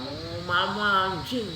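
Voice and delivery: slow, muted mock child's yell; anak berteriak. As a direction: imitate a shouting child without the volume. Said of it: "ada anak teriak-teriak pagi-pagi". (menirukan teriakan anak dengan suara pelan)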